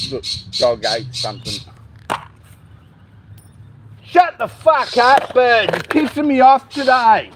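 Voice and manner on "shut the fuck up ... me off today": loud, higher, angry snap at the bird; yelling at bird. Real, not acted. Owner yelling at a bird that won't stop: loud angry shout. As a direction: tell the noisy bird off, loud and fed up.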